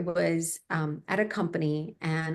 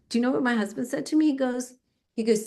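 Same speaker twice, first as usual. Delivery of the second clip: ordinary talk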